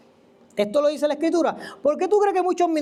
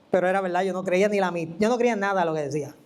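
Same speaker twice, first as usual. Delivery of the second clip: ordinary delivery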